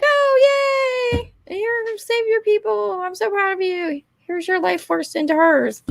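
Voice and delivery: very high, drawn-out cartoon voice; mock gushing heroine. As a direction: coo it high like a melodramatic heroine. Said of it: "and she's like".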